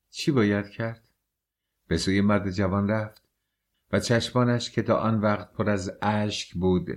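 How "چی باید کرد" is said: plain reading voice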